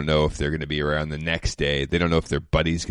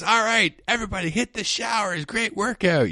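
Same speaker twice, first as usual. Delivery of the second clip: high-pitched holler, imitating a coach; mock a thrilled coach yelling to the team, pitched way up; excited yelling coach. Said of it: "he's yelling and screaming".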